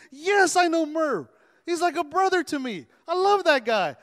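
big, emphatic burst of affection; enthusiastic recognition. Acted. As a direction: exclaim it about a dear friend. Acting out love for close friend.

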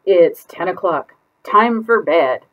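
drawn-out grandma bedtime call; grandmother calling. Acted. Grandmother calls to bed.

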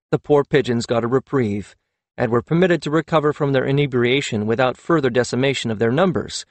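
ordinary delivery

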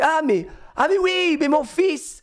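put-on high, gushing English-accented French. English mother exclaiming over her son, imitated English accent. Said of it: "Elle avait un, un accent anglais de fou".

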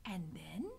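soft whispered question; hushed prompting. A narrator's reading. Rat prompts in secret.

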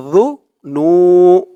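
yu, nu sounded out slowly one by one; syllable by syllable. Shown as a demonstration. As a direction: break the word into its syllables. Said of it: "নু একালিফ টান".

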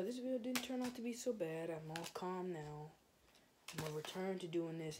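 normal talking voice

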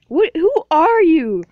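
a pretend character demanding who's there; alarmed shout. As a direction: yell it out in mock fright.

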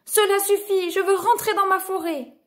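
high, fed-up exclamation; exasperated storybook cry. A narrator's reading. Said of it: "cria-t-il".

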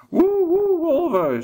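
high, loud howling 'woe' call; wolf shout. A narrator's reading. Werewolf shouting to the pack as a buffalo flees, high howling shout. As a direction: howl it out to the pack. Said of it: "schreeuwde de woewolf".

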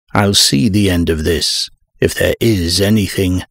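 ordinary delivery

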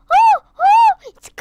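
high, loud squeal as it starts; excited. Real, not acted. Kid squealing as the ride starts going. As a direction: yelp with thrilled surprise.

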